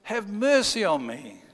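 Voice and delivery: high, loud plea for mercy; crying out. Acted. Blind beggar shouting to passing Jesus, high and loud. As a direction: call out desperately from the roadside. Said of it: "he cried out".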